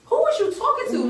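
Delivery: high, challenging demand; sassy challenge. Retold or imitated. Girlfriend told her to shut up, so she calls her out. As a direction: call her out, high and pointed.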